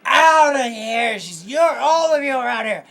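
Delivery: high, loud shouting barmaid; angry barmaid. Acted. Barmaid throws the party out, loud angry yelling. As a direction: throw them out at the top of your lungs. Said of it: "the lady behind the bar comes around and she's like".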